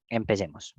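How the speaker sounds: usual speaking voice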